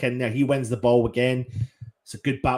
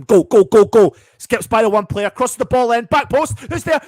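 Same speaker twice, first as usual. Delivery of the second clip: loud, higher and climbing with the attack; excited yelling; 9 semitones higher, 10 dB louder than usual. Real, not acted. Fan urging West Ham on during attack, loud and excited. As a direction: yell them forward as the cross comes in. Fan watching a cross fly in.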